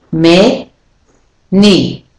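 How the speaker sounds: "Me, ni" in two separate, equal beats; vowels said clearly. Shown as a demonstration. Teacher repeating the syllables of many, clearly sounded. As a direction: give "Me" a clear e, pause, then a full "ni". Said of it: "it's saying e".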